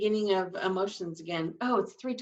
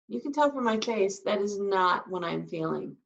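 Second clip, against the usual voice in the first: usual speaking voice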